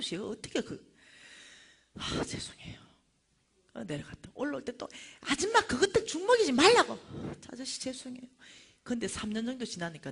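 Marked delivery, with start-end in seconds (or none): 1.9-2.8 whispered sorry; meek apology. Retold or imitated. Preacher apologizing to the angry vendor, meekly whispered. 5.2-7.0 high, loud reprimand; imitated angry vendor. Tape seller scolding preacher again, shouting angrily. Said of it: "막 난리나". 7.5-8.3 soft, deferential sorry; meek apology. Retold or imitated. Preacher appeasing the scolding tape seller, meekly.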